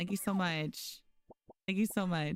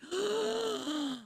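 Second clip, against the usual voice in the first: sharp high gasp; shocked gasp; 13 semitones higher than usual. Real, not acted. Reading a friend's hospital news, a shocked gasp.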